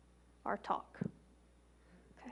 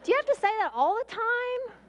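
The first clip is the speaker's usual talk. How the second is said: much higher, louder mock-exasperated kid voice, laughing at the end; teasing teenage daughter; 9 semitones higher, 5 dB louder than usual. Middle child teasing mom about the forever line, high and laughing. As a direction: play the eye-rolling daughter groaning at mom. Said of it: "And my middle child goes".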